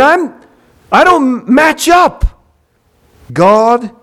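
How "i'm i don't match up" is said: slow, shouted confession of falling short; anguished cry. Real, not acted. Confessing his failure.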